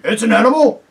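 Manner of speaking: loud, gruff-voiced bulldog. A narrator's reading. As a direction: bark out the discovery.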